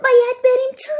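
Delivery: high, loud panicked shout. Acted. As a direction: yell it over the wind. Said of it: "فرانکلین داد زد".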